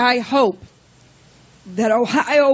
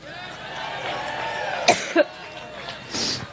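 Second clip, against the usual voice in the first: deep hacking cough; chesty cough; 9 dB quieter than usual. Real, not acted. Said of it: "excuse me".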